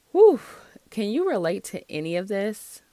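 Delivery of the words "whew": a sharp, high, loud exhale of relief; loud whew. Real, not acted. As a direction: blow it out like a heavy load lifted. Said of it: "reacting to the article".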